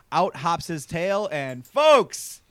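building to a loud, high announcement; hyped reveal. Real, not acted. Podcast host hyping a villain's return, loud and rising. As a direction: announce him like a wrestler entrance.

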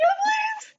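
very high, breaking gratitude; high-pitched tearful thank-you; squeaky gratitude. Retold or imitated. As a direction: leap way up in pitch like a grateful sob. Accepting water while crying.

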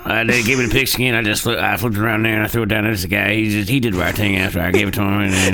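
low, froggy mock interview voice; frog-in-throat football player. Cohost parodying the quarterback's post-game interview, low and froggy. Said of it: "He's got a frog in his throat".

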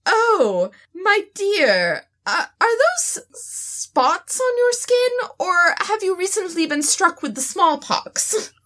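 high, posh British drawl, slowed on the middle; hammed-up upper-class British lady at high tea; fancy British dinosaur. Player asking about spots in a fancy British voice. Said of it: "talk like a fancy British dinosaur".

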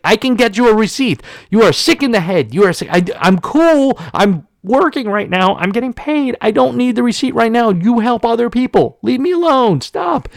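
high, rising mock rant in a put-on accent; mock angry yell. Attendant ranting at the driver, hammed-up angry yell. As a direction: ham up the furious attendant.

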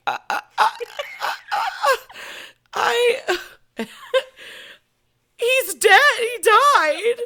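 stammered high sobs breaking into a loud wail; retold choked-up crying. Telling husband he died, hammed-up choked sobbing. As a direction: imitate being too upset to get the words out. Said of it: "I couldn't even say it".

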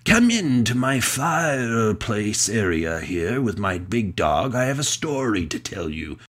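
slow, cosy fireside tale-teller voice; The Storyteller. Acted. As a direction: invite listeners in like an old fireside narrator. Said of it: "the storyteller from Jim Henson's The Storyteller".

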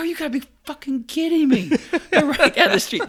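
high, loud, laughing disbelief; retold outburst. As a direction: relive losing it over the cranes. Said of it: "I just lost my shit".

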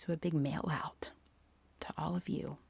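playful whisper. Real, not acted. Teasing the giveaway.

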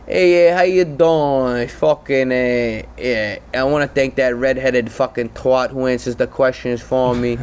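lightly voiced New Jersey wiseguy; Sopranos mobster. President back from a coma, Sopranos-style wiseguy. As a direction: talk like a TV mobster. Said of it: "like, a Sopranos character for the next couple weeks".

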